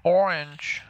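put-on American accent on orange; a quick American accent. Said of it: "I said orange in an American accent".

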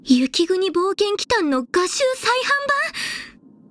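high, drawn-out gasp of disbelief; astonished excitement. Acted. Fan learns of an art book reprint, astonished. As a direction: rise in amazed delight.

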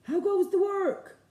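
high, loud farmer's call; inviting children to join the farmer. Shown as a demonstration. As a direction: say it like the lazy farmer. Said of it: "Can you say it?".

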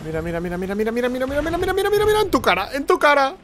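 rising, frantic gamer gloat; triumphant taunt. Real, not acted. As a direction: chant climbing steadily to a very high squeal. Taunting enemy while scoring.

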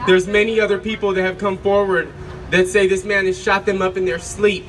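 ordinary talk, nothing marked

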